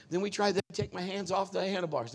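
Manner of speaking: ordinary talk, nothing marked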